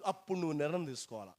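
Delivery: ordinary talk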